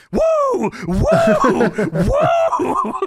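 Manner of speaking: high, hammed-up Ric Flair woos; laughing mock wooo. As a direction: squeal woo like Ric Flair. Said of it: "Flair's argument back is".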